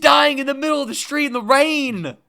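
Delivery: very high, loud insistence; overwhelmed pleading tone; very high, loud exasperated insistence. Real, not acted. Insisting on sad scene.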